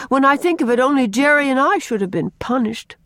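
mild remorse, emphatic; remorseful. A narrator's reading. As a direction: take the blame like a sorry girl. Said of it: "said Faith remorsefully".

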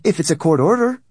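high, loud insistence; emphatic retort. A narrator's reading. Woman finishes emphatic retort.

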